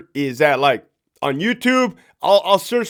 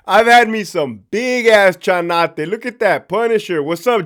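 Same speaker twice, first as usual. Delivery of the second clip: usual speaking voice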